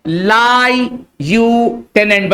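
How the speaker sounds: "लाई यू टेनेंट" split slowly into three parts; spelling syllables. Shown as a demonstration. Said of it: "इसका spelling में क्या लिखा मैंने?". ("लाई यू टेनेंट" धीरे धीरे टुकड़ों में)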